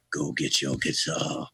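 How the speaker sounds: low, slow drunken rock-star imitation. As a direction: drag out the order like a tipsy singer. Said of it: "he looked like he had a few".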